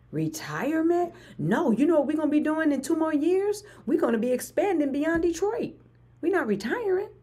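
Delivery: high, incredulous, almost squealing; incredulous retirement rejection. Real, not acted. Brushing off retirement talk to her viewers, high and incredulous. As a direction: laugh off the very idea of retiring.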